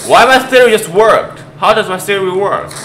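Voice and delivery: high, loud disbelief; astonished outburst. Real, not acted. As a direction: blurt it out in amazement. Amazed that the theory worked.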